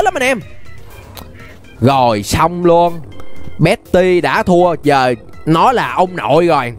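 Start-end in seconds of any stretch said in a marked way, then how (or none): none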